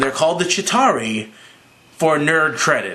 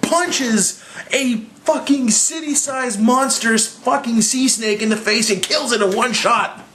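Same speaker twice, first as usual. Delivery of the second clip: excited fan narration, raised high; hyped retelling; 9 semitones higher than usual. Real, not acted. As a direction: tell it like the best moment ever.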